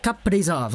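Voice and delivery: Kaprizov split as kap-PREE-zov; slight break in Kaprizov before "prizov"; both halves of Kaprizov weighted, briefly separated; little break after Kap. Retold or imitated. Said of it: "there's a little break there".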